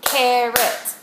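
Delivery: "Carrot" loud, broken into its two syllables. Shown as a demonstration. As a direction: clap out "Carrot" in two firm beats. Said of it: "clap out the syllables".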